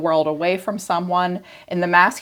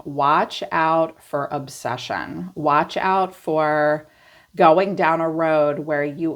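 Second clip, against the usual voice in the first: slow warning; slower than usual. Real, not acted. Warning the listener about obsession.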